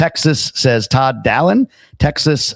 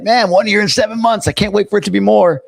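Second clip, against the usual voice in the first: high-pitched put-on enthusiasm; mock eagerness; 9 semitones higher than usual. Host imagining a viewer keen to stay longer, mock eagerness.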